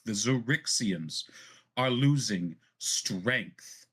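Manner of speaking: controlled indignation, key words driven home; impassioned plea with a touch of fury; indignant fury. A narrator's reading. Zadali urges Valen that her people need change, indignant. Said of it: "You can feel the indignation, the fury".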